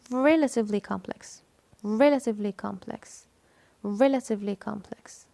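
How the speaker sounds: "relatively" punched on its first syllable; stress on RE. Shown as a demonstration. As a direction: lean on the opening "re" of "relatively". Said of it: "So the stressed syllable is". (التشديد على "re" في "relatively")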